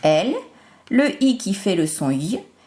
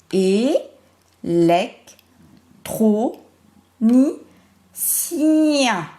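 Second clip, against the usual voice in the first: "Électronicien" very slow, each syllable held long; stretched syllables; much slower than usual. Shown as a demonstration. Teacher showing the sound at the end, "Électronicien" greatly stretched. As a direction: draw out "Électronicien" in five parts, longest on the final ciiien. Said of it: "On l'entend bien à la fin".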